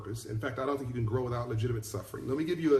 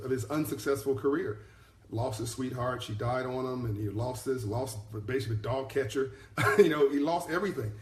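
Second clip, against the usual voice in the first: usual speaking voice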